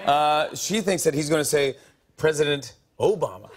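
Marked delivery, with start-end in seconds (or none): 2.2-3.5 "President Obama" echoed with the start of "Obama" lifted; imitated odd inflection. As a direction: copy a guess, leaning on the O of "Obama". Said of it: "a different inflection on a different letter".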